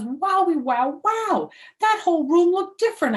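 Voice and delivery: high, loud wowie wow; a kid stunned by what she sees; amazed. A narrator's reading. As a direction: gasp it out in wonder. Narrator amazed at room.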